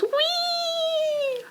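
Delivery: high delighted squeal; gleeful whee. Acted. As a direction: squeal with joy. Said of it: "he goes".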